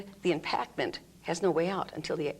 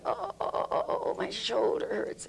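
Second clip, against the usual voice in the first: tense groaning; 5 semitones higher than usual. Shown as a demonstration. Teacher imitating someone with a tense shoulder. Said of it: "there'll be a".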